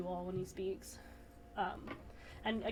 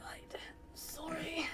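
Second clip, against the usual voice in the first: whisper; 7 semitones higher, 6 dB quieter, slower than usual. Real, not acted. Apology after talking in council.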